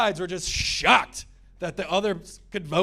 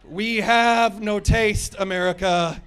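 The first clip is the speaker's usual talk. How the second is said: slow declaration; 3 semitones higher, 4 dB louder, slower than usual. Acted. Punchline to crowd.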